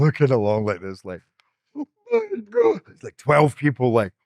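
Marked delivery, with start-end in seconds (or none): 1.8-2.8 awed gasp. Retold or imitated. Retelling the stunned screening room.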